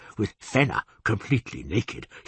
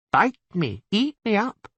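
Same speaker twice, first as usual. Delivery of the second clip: put-on girlish squeal; 12 semitones higher, 3 dB louder than usual. Quoting a girl's cries.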